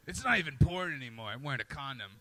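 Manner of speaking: a hint of a low, weary old-timer; grizzled porn star. Grizzled porn stars mourning the industry, lightly voiced low grumble. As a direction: grumble like a jaded veteran. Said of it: "they're like".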